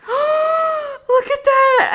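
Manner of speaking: big gasp, then high, drawn-out cry; horrified shock. Real, not acted. Showing viewers the filthy brush water, horrified shock. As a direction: gasp and exclaim, appalled by what you see.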